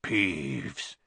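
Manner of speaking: low, hoarse and hushed; hoarse whisper. A narrator's reading. Harry posing as the Baron, hoarse whisper. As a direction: rasp the name low. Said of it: "in a hoarse whisper".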